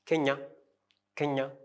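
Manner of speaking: "Kenya" with no N at the end; masculine, silent N. Shown as a demonstration. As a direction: stop "Kenya" on an open nasal vowel, final N silent. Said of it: "we don't pronounce the N".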